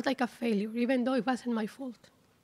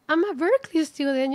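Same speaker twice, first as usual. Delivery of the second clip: louder, bursting with pride; proud exclamation; 4 semitones higher, 6 dB louder than usual. Retold or imitated. Student stepping onto campus, proudly exclaiming. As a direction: announce it to yourself, thrilled. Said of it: "I was like".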